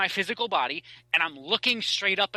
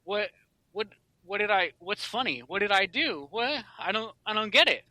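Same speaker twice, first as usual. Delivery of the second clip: confused; 4 semitones higher than usual. Retold or imitated. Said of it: "looking around all confused".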